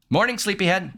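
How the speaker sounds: high sing-out to a sleeper; high-pitched morning greeting; cheery wake-up call. Acted. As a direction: play someone rousing a sleepyhead. Acting a wake-up call in game.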